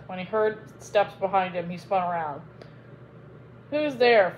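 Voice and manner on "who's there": a loud call into the dark. Acted. Phoebus calls out to the footsteps behind him, loudly. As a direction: call out to the unseen steps. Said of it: "Phoebus called out".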